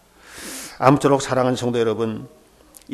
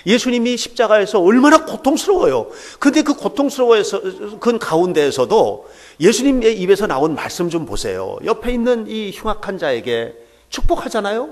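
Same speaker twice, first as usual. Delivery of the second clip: higher, louder impassioned sermon delivery; fervent preaching; 6 semitones higher, 4 dB louder than usual. Acted. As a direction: preach it with rising fervor. Preacher rising in fervor. (열정적인 설교)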